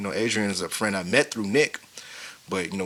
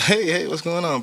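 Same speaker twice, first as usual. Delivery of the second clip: high greeting; 8 semitones higher than usual. Retold or imitated. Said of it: "I said".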